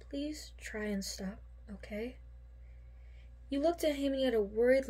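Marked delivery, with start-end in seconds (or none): 0.1-2.2 soft plea. A narrator's reading. Worried plea about self-harm.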